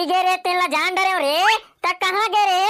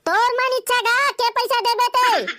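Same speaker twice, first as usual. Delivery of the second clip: a high-pitched yell; shouting; 5 semitones higher than usual. Acted. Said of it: "कान नाक पूरा भर जाते साले चिल्लाता ते".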